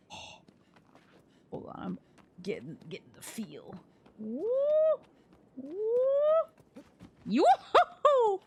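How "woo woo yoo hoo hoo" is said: high, drawn-out whooping calls; hollering in a forest. Real, not acted. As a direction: hoot and holler into the woods. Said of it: "I'm hooting and hollering".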